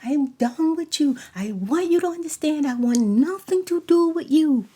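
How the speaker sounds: shouting he's done with him; angry retort. Retold or imitated. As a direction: tell him off for good. Reenacted angry confrontation.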